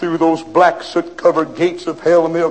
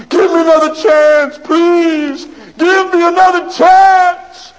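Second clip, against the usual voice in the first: high, loud wailing plea; desperate crying plea; 11 semitones higher, 5 dB louder, slower than usual. Acted. Condemned man wailing to the governor for another chance. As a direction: beg through tears for mercy. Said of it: "could still hear him crying".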